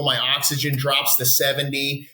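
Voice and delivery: ordinary talk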